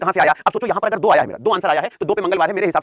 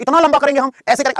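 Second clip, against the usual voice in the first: higher, louder whiny student; mock student voice; 7 semitones higher, 5 dB louder than usual. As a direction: imitate a doubting student, a bit higher. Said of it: "जो तुम लोगों को लगता है". (ऊँची आवाज़ में छात्र की नकल)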